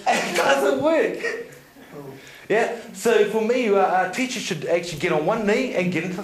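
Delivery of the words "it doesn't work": slow drawn-out words through laughter; laughing slowly. Real, not acted. Speaker to audience about bad teachers, slow and laughing. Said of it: "I'm like".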